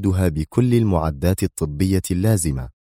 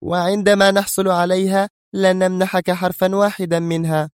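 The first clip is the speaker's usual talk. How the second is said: high-pitched, defiant woman's voice; very stern; 12 semitones higher than usual. Acted. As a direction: refuse him firmly, pitched way up. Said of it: "في صرامة أكبر". (بصرامة شديدة)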